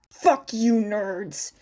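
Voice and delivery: a touch of playful cursing; mock cursing. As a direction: snap it at the twins. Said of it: "he suddenly cursed the twins".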